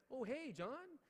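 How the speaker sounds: very high, awkward mock greeting; caught off guard. Rookie teacher caught by the custodian, high flustered greeting. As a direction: squeak out a sheepish hello.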